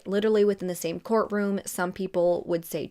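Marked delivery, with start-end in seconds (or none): none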